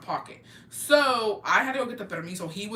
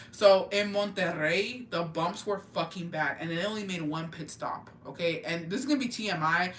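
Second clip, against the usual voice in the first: usual speaking voice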